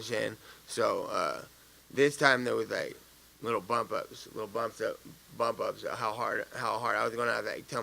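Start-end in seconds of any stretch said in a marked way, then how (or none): none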